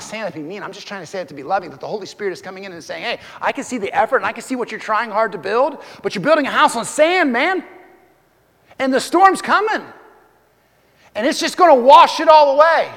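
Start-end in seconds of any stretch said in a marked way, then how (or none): 3.0-12.9 loud, high preaching, building up; preaching at full force. Acted. Preacher warning the church the storm is coming, shouting. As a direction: cry it out over the crowd.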